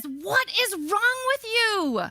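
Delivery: high, drawn-out mock outrage; exasperated passenger. Panicking woman turns on her seatmate, high mock exasperation. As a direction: stretch it out in a high, incredulous wail.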